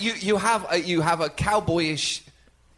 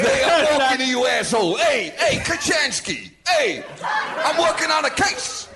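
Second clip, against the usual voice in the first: shouted, higher New York tough guy; New York cop accent; 5 semitones higher than usual. Acted. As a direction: yell it like a street cop on a case. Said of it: "you work in New York a lot".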